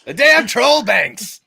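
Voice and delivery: high, drawn-out mock yell; mock outburst. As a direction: cry it out loud and slow, high-pitched. Acted outburst about troll banks.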